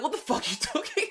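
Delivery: high, loud mock outrage; incredulous mock-annoyed squawk; imitated annoyance. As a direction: snap it in exaggerated irritation. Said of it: "They get, like, really annoyed with me".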